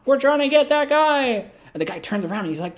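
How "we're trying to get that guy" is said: high, strained yell; mock mob shouting. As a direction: holler through a door like an angry gang. Mob shouting outside plane door.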